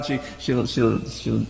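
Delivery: usual speaking voice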